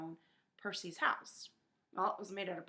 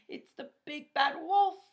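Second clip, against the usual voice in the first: high, loud frightened voice; a scared pig chattering out the news; frightened pig spotting the wolf; chattering fear; 8 semitones higher, 7 dB louder than usual. A narrator's reading. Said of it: "Boris chattered".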